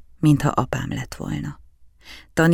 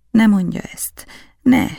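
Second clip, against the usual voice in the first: put-on higher, louder pleading boy; pleading child; 7 semitones higher, 5 dB louder than usual. A narrator's reading. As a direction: beg him to stop, close to tears. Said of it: "kértem". (kérlelő gyerekhang)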